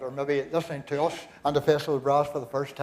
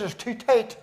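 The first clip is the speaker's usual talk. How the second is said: laughing punchline; 7 semitones higher, 4 dB louder, slower than usual. Real, not acted. Punchline of the tie joke.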